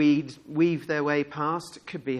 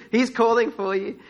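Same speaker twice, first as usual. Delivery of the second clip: amused bystander; 6 semitones higher, 5 dB louder than usual. Acted. Bystanders' quote, laughed through.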